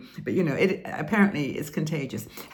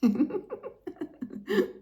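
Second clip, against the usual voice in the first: high forced demo giggle; fake giggle. Shown as a demonstration. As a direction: giggle on cue, high and light. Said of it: "everybody do it after me".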